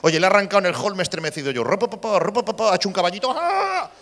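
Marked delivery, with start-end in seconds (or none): 1.6-2.7 sputtering engine noise made by mouth; put-put motor mimicry; imitated motor. Imitates the outboard motor starting. 3.4-3.9 high, drawn-out mock cry; high-pitched wheelie sound effect; imitated revving cry. Acts out the robot's wheelie.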